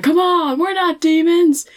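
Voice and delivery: high, insistent comic protest; hammed-up aggressive protest, pitched high; mock aggressive. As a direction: push back in mock exasperation. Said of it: "Dean's so aggressive when he's like".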